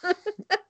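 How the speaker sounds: short high-pitched laugh. Real, not acted. Laughing at obese joke.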